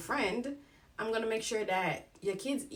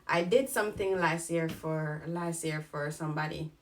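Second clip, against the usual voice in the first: normal talking voice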